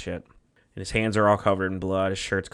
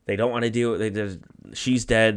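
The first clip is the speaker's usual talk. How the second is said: normal talking voice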